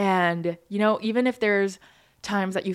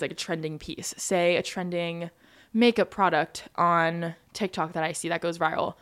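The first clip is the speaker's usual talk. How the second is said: ordinary talk, nothing marked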